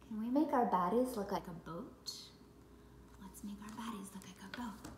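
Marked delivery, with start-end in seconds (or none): 3.2-4.9 soft whispered repeat; whispering. Acted. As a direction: whisper it gently to the kids.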